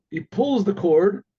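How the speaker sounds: usual speaking voice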